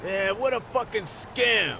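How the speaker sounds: high, drawn-out disgusted gripe; angry agreement. Acted. Friend agreeing that the game is a scam, angry and drawn out. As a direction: stretch the curse out in high-pitched outrage.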